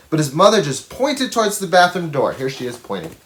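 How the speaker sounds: plain reading voice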